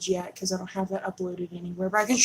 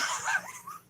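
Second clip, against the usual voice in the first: high giggle; 7 dB quieter than usual. Real, not acted. Laughs at silly username.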